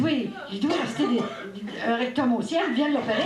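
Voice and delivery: voix plus aiguë, ordre à moitié rieur; prière en riant. Retold or imitated. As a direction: sommer la sainte d'opérer, en riant. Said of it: "J'ai dit". (la mère supplie Sainte-Anne, aiguë et rieuse)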